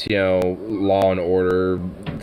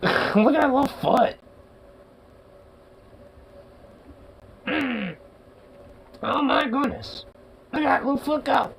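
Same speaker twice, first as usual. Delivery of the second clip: put-on much higher, cooing baby-talk voice; baby talk to dog. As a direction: coo at the puppy like a tiny baby.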